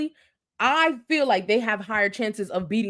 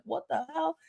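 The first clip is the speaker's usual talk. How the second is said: soft, higher-pitched mock disbelief; imitated shock; 5 semitones higher, 6 dB quieter than usual. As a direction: act stunned on someone's behalf, keeping it small. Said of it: "Beth is so shocked".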